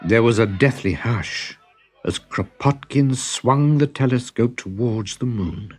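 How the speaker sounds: plain reading voice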